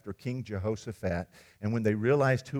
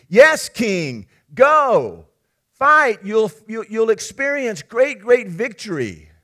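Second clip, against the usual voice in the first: loud, high, rousing cheer of yes-men; enthusiastic cheering; 10 semitones higher, 11 dB louder, slower than usual. Acted. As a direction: whip up the king like cheering flatterers. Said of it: "And they're enthusiastic".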